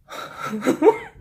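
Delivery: a quick, high, startled intake of breath; surprised gasp; sharp gasp. Real, not acted. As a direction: gasp as if something just hit you. Sudden reading reveal, gasp.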